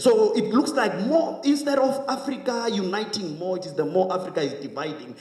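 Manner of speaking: stirring political speech; impassioned oratory. Real, not acted. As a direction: address the hall like a rousing orator. Impassioned oratory on African unity.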